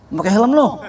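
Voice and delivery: suara teman yang lebih tinggi dan keras, menegur. Retold or imitated. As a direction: tiru teman yang cerewet menyuruh pakai helm. Teman menyuruh pakai helm. (teman menyuruh pakai helm, nada menegur lebih tinggi)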